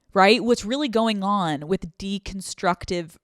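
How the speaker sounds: ordinary talk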